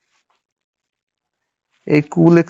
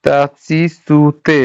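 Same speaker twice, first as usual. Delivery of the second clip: তাচিসুতে spelled out very slowly, each syllable separated; much slower than usual. Shown as a demonstration. Teacher to students, slow demo of তাচিসুতে. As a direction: sound out তাচিসুতে slowly for the learner.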